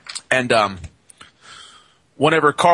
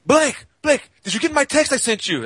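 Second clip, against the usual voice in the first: imitating Laborio; 13 semitones higher than usual. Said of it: "he'll go".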